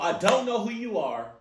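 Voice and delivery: usual speaking voice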